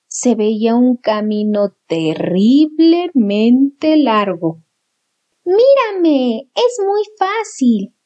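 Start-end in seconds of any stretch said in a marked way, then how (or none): 5.4-7.9 put-on high show-off young owl; high, slow cheerful owlet. Brother shows off flying.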